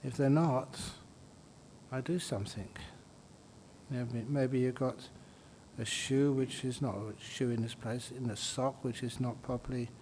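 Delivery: soft, unhurried meditation voice with long pauses; guided meditation. Real, not acted. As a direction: gently guide them through the body, softly. Leads a body-scan meditation.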